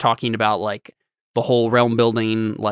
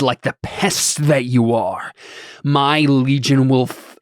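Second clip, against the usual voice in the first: slow, grand villain threat; lofty; 3 semitones higher, 3 dB louder, slower than usual. Acted. A lord threatening the intruder and servant, lofty and menacing. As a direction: declaim each phrase slowly with dramatic weight. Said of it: "we sound so lofty".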